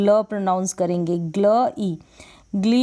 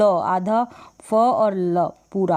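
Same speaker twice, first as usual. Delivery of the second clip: slow explanation; 2 semitones lower, much slower than usual. Shown as a demonstration. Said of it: "pronounce कर रहे हैं फ्ल".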